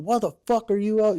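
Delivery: high, loud demanding yell; angry drill instructor. Retold or imitated. Drill instructor demanding why the recruit is up, angry yell. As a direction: demand an answer like a furious DI.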